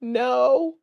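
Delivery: imitating a small broken-voiced answer; mock-pitiful reply, pitched slightly up. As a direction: give a feeble, faked broken-voice answer. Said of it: "broken voice".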